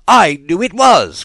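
high, loud, wild outburst; furious cry. A narrator's reading. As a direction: Tweedledum raging over his rattle, high and loud. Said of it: "cried Tweedledum".

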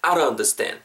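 "I don't" slurred into "a-ron"; flapped "don't". Shown as a demonstration. Teacher leading a repeat-after-me, "I don't" as "a-ron". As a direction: tap an r in place of the d in "don't". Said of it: "우리말의 리을로 발음된다라고 생각하시면".

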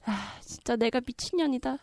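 unvoiced self-scolding whisper. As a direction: hiss it at yourself. Self-reproach aside.

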